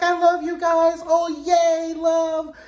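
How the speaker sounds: high, loud gushing squeal of love; a giddy high yell of love; drunk gushing. Retold or imitated. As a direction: overflow with tipsy affection, half shouting. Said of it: "she's really being freaky".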